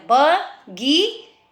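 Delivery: बगीचा stretched very slowly, ब गी चा; careful drawn-out teaching word; slow syllables. Shown as a demonstration. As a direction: draw out बगीचा. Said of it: "च को मैंने आ बोला है".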